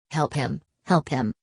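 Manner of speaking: ordinary delivery, nothing marked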